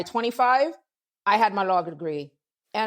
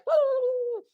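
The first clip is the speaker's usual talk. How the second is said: much higher joyful woo; excited whoop. Retold or imitated. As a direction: whoop out like greeting your sister. Calling out on the phone.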